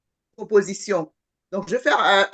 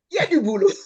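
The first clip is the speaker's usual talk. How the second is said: Audrey's catchphrase; 3 semitones higher, 4 dB louder, much faster than usual. Retold or imitated. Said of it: "comme l'aurait dit Audrey".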